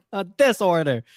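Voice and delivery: "this order" said through a laugh. Shown as a demonstration. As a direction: laugh while saying "this order". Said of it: "heavy Chicago accent".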